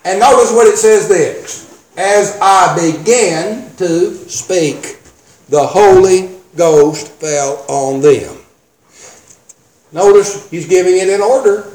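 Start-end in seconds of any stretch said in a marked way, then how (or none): 2.0-8.4 very slow scripture reading, phrase by phrase; slow deliberate reading. Shown as a demonstration. Preacher showing congregation the order of events, slow deliberate reading. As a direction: draw each word out with weight. Reads verse slowly for emphasis.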